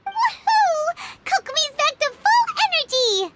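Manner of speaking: high, loud excited cheer. Acted. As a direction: celebrate loudly. Paimon cheering.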